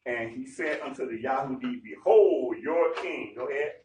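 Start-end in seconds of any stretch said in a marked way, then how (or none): 1.8-3.3 very slow, weighty Behold your king; teacher rereading Behold your king slowly for emphasis; emphatic reread. Shown as a demonstration. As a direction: drag it out for weight. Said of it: "rereading for emphasis".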